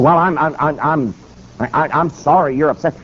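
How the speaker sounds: imitated stuttering apology; stammering. Rattled governor apologizing to the preacher, stammering. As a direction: trip over the first words, backing down. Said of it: "the governor is stammering and stuttering".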